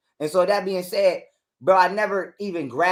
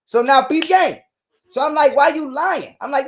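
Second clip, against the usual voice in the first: raised, higher angry retelling; heated and loud; 7 semitones higher, 9 dB louder than usual. Retold or imitated. Man venting to podcast host about wife lying. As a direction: let the outrage burst out loud.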